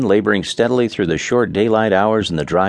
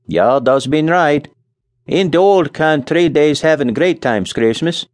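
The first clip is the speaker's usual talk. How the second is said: put-on Scandinavian accent; 8 semitones higher, 4 dB louder than usual. A narrator's reading. Nels voiced, accent.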